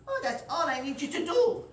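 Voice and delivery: put-on high, bright leprechaun girl voice; delighted little leprechaun; Lucy's voice. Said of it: "She goes".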